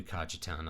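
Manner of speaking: Cajetan as "KAH-juh-town"; hard English J in Cajetan, said low and soft; pronounced with J. Shown as a demonstration. Streamer settling on a donor's name, Cajetan said softly as "KAH-juh-town". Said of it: "I'll do the, the J".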